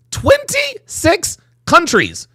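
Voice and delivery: high, drawn-out disbelief; incredulous emphasis. Real, not acted. Host astounded by the twenty-six countries, incredulous. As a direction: stretch each word out high, astonished.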